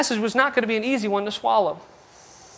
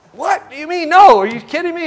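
mock disbelief; 5 semitones higher, 4 dB louder than usual. Preacher stunned by refusal.

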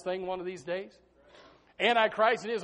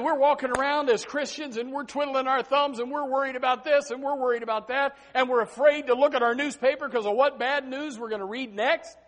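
ordinary delivery

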